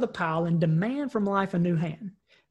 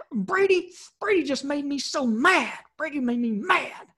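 lightly voiced upset little boy, higher; a hint of a sulky kid complaining; upset child; 6 semitones higher than usual. Son complaining his little brother made him mad, higher whiny child. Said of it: "he was mad, man. He was crying".

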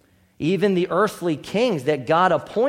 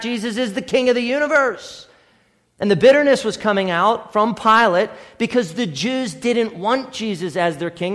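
usual speaking voice

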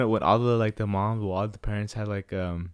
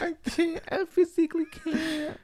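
squeaky high voice; slower than usual. Retold or imitated. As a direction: squeak it out in a much higher mock voice. Friend repeats the squeak.

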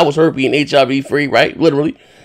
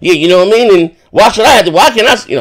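ordinary talk

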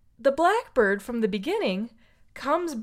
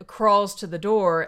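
ordinary delivery

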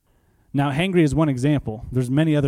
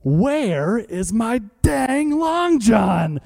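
angry yell; 7 semitones higher, much slower than usual. Retold or imitated. Hangry buddy yelling at the donut counter, drawn-out roar. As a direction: bellow it like a starving man who has lost it. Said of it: "he goes".

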